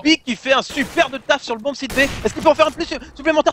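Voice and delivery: high, hyped play-by-play call; fighting game announcer. Real, not acted. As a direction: call the triple kill like an arena announcer. Caster calls a triple kill.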